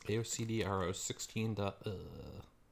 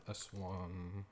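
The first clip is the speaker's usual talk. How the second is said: muttering to himself; 13 dB quieter, slower than usual. Real, not acted. Muttering code while typing.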